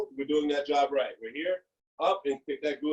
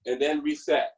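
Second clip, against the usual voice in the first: ordinary talk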